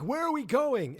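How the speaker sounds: high, loud panicked question; imitated panic. Steve and Robin panicking in the car, high loud alarm. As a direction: cry it out in mock panic. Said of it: "Steve and Robin are panicking".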